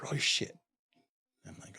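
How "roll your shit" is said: same order, now whispered and breathless; retold whisper. Guard repeats the order to the inmate in a whisper.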